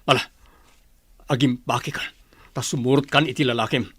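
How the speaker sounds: ordinary delivery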